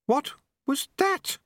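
very high, slow, stunned question; stunned Unity. Acted. Unity asking after the sudden silence, stunned, high and slow. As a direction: ask it haltingly in a high voice.

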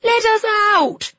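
loud, high-pitched desperate shout. A narrator's reading. Children banging on the van sides, shouted. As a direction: yell to be let out. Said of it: "they shouted".

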